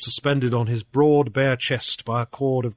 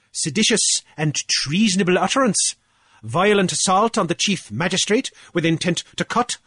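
pompous official, pitched higher, boasting; blustering Cornelius; 8 semitones higher, 3 dB louder than usual. Acted. Cornelius gloating to Grief over the fines, higher and pompous. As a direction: puff up like a petty island official lording it.

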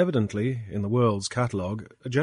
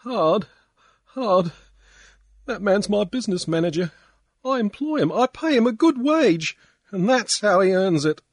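high, loud, tearful wail; sobbing drunk; 11 semitones higher, 6 dB louder than usual. A narrator's reading. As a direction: wail it through drunken tears. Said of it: "he sobbed".

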